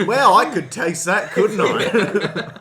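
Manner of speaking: mock amazement, laughing; sarcastic wow. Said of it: "It's like".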